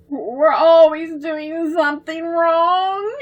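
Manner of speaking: high, slow crying voice; tearful. Acted. Debbie crying over the sketch, tearful. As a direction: sob it out in despair.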